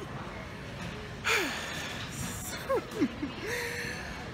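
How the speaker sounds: a long, high, shaky sigh after crying; high, exhausted sigh of defeat; long weepy sigh. Real, not acted. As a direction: let the sigh out like you're done with the day. Crying over no food on flight.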